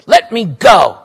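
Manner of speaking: a loud, desperate protest; struggling cry. Acted. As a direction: fight free of her grip. Girl struggles against old woman.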